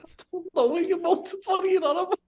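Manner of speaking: very high, squeaky protest through held-in laughter; retold high-pitched, giggly denial; stifling laughter. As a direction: squeal it while trying not to laugh. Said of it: "I'm trying not to laugh".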